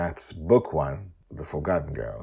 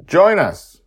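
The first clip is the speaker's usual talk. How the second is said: high, loud invitation; shouting; 17 dB louder than usual. Acted. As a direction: call out joyfully. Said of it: "Madeline shouts at him".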